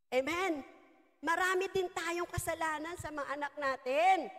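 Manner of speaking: ordinary talk, nothing marked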